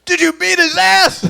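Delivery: loud, high demand; outraged dad. Retold or imitated. Dad reacts to what son was called, loud outrage. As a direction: fire it out as a shout. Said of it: "He goes".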